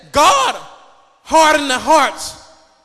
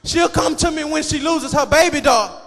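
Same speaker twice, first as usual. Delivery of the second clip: normal talking voice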